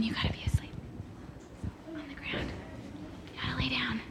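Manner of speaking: hushed whisper to the sleeping lion. Real, not acted. Teacher coaching the lion actor, whispered. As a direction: whisper so the lion stays asleep.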